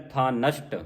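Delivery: ਥਾ apart, then ਨਸ਼ਟ with sh; words split correctly. Shown as a demonstration. Teacher giving correct reading, ਥਾ ਨਸ਼ਟ split in two. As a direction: separate ਥਾ from ਨਸ਼ਟ. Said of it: "ਇਹ ਵੱਖਰਾ ਕੀਤਾ ਜਾਵੇ".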